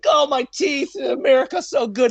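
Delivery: high, loud, gushing broken English; old interpreter showing off his new teeth to the soldiers; excited grateful man. Retold or imitated. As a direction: gush like a thrilled old friend. Retelling grateful interpreter's words.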